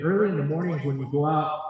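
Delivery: ordinary talk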